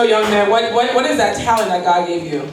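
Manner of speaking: usual speaking voice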